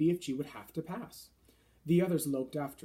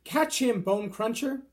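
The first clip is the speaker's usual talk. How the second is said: loud, higher game call; shouted; 7 semitones higher, 7 dB louder, slower than usual. A narrator's reading. Fleshlumpeater tossing the BFG to Manhugger, shouting. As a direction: fling the words after the thrown giant.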